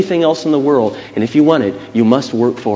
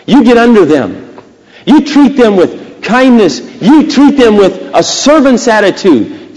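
high, slow, punched preaching; forceful preacher, stretching the key words; emphatic preaching; 7 semitones higher, 4 dB louder than usual. Real, not acted. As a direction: hammer each instruction into the congregation.

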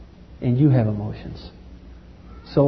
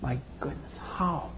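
hushed then rising anguished plea; a hint of grieving God; God's lament; 11 semitones higher, slower than usual. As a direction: speak as a heartbroken father. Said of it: "God said to Himself".

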